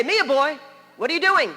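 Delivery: loud, high-pitched retold scolding; imitated motherly telling-off. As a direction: call out like a cross mother. Mother scolding children in a story.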